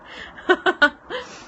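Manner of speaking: high giggle; high laugh. Real, not acted. Laughing at own joke.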